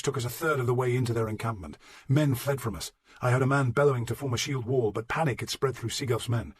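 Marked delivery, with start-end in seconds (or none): none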